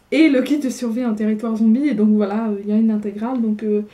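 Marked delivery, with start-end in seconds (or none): none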